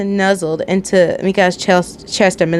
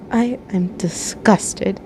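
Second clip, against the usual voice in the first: slow, miserable mumble; 5 dB quieter, much slower than usual. A narrator's reading. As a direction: mumble it, sick and ashamed. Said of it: "you mumbled miserably".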